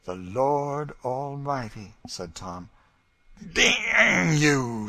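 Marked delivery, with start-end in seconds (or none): 0.0-2.0 reverent answer. A narrator's reading. 3.5-4.9 much higher, loud outburst; furious curse. A narrator's reading. Legree striking Tom.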